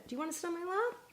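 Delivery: high, soft coaxing call to the cat; pet talk to cat. Real, not acted. Coaxing her cat Freya up onto her lap, high and soft.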